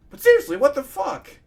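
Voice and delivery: high, loud exasperated outburst. Real, not acted. Reader fed up over accents for characters, exasperated outburst.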